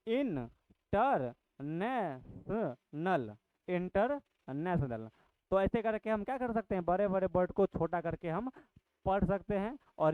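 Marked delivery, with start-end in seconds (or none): 0.1-5.1 इंटरनेशनल read slowly in separate syllables; slow, piece-by-piece इंटरनेशनल; broken into syllables. Shown as a demonstration. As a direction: chop इंटरनेशनल into pieces as you say it. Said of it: "खंड टुकड़े में कर देंगे".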